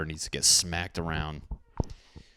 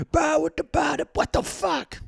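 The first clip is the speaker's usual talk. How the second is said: silly high voice; 4 dB louder than usual. Acted.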